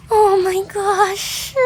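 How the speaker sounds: high, slow, drawn-out exclamation; pained mock outcry. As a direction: stretch it out high in mock agony. Acted reaction to nail in thumb.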